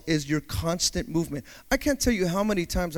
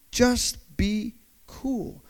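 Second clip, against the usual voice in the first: soft slow calm; 3 semitones higher, 5 dB quieter, much slower than usual. Acted. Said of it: "slow soft calm".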